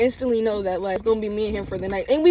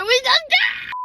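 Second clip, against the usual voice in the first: excited squeal; 3 dB louder, slower than usual. Retold or imitated. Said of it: "I was like".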